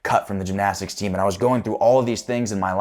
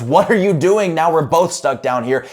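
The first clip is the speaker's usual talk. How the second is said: much higher and louder, in disbelief; shocked outcry; 9 semitones higher, 8 dB louder than usual. Retold or imitated. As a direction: blurt it out stunned that he jumped in. Friend jumps into the hole.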